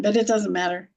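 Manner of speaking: ordinary talk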